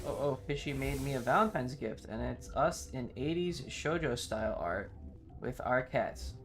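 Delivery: ordinary talk, nothing marked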